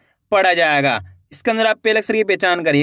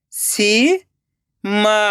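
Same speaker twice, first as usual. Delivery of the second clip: सीमा split into सी, long pause, मा; rising सी, then मा alone; syllable by syllable; much slower than usual. Shown as a demonstration. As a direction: sound out Seema very slowly in two parts. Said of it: "एक एक अक्षर का उच्चारण करना है".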